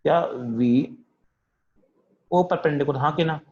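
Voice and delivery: ordinary talk, nothing marked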